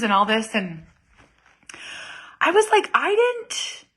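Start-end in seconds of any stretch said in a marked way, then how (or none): 2.4-3.8 mock surprise.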